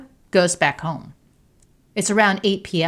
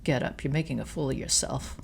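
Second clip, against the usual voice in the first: low, softer cold contempt; 3 semitones lower, 7 dB quieter than usual. A narrator's reading. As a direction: look down on her as you speak. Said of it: "with contempt".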